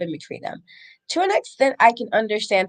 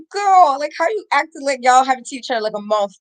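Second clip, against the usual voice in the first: put-on higher and louder girl, quick follow-up; sassy disbelief; 5 semitones higher, 5 dB louder than usual. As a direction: call out the pretence, fast. Host voices Crush's disbelief.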